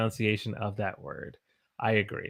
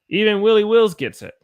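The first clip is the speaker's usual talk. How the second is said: loud, high burst of praise; excited praise; 8 semitones higher, 8 dB louder than usual. Real, not acted. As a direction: cheer the student on. Excited teacher praising a student.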